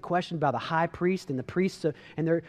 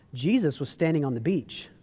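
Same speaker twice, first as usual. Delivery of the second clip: normal talking voice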